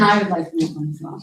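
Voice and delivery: speaking through a face mask; words dulled by a mask; muffled behind mask. Real, not acted. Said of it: "can you hear me with the mask".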